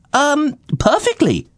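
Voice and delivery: very high, relieved reply; relieved chirpy lad; relieved young fellow. Acted. Splidge relieved answer.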